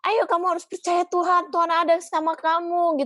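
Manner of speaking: mock the friend who just shouts encouragement; pushy cheerleading. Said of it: "kamu nggak perlu harus kayak".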